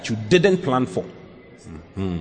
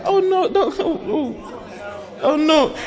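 very high, giggly fake refusal; flirty mock protest; much slower than usual. Shown as a demonstration. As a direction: play coy, laughing through the no. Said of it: "depends on how you said the no".

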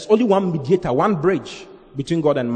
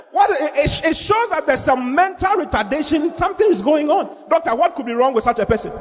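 high-pitched, incredulous sermon tirade; impassioned preaching; 12 semitones higher than usual. Real, not acted. Preacher asks what is wrong with such a person, impassioned.

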